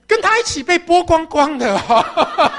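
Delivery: slow, loud, laughing through it; giggling at the memory. Acted. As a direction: laugh while drawing it out. Thief recalls shame, laughing.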